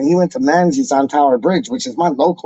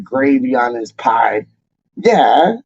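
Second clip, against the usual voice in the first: usual speaking voice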